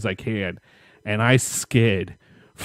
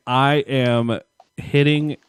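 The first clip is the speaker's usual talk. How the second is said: usual speaking voice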